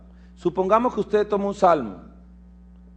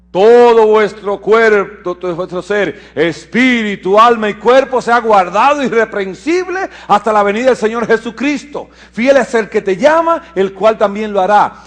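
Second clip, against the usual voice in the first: proclamación de las Escrituras; 4 semitones higher, 6 dB louder than usual. Acted. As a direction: proclaim it forcefully from the pulpit. (versículo declamado con fuerza)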